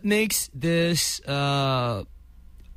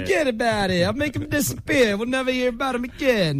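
high-pitched mock Italian mobster; mob accent; 7 semitones higher than usual. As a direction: play a mafia fixer in a hammed-up accent. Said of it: "you're doing more Italian mafia".

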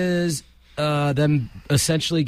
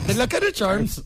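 higher, badly faked Irish; bad Irish accent; 5 semitones higher than usual. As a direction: repeat a botched leprechaun line in mock Irish. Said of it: "but I said".

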